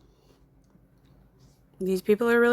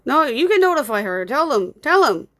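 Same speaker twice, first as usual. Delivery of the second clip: raised, higher, insistent anger; really mad; 5 semitones higher, 5 dB louder than usual. Real, not acted. Streamer reporting a commenter for bullying, really mad. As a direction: push each command out louder, really mad. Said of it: "Sorry, I'm really mad".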